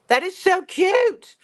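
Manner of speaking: high, slow, loud squeal on cute; gushing squeal. Real, not acted. Gushing over finished soap.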